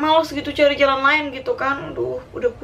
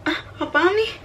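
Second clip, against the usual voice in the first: a reenacted gasp of alarm, pitched higher; startled, panicked; 8 semitones higher than usual. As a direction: blurt it out in sudden alarm, pitched up. Discovering blood on the towel. (kaget dan panik, nada tinggi)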